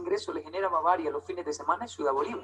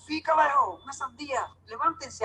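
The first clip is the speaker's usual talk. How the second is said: higher, eager wake-up call; excited calling; 7 semitones higher, 4 dB louder than usual. Retold or imitated. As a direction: rouse the cousins with the big news. Waking cousins with the news.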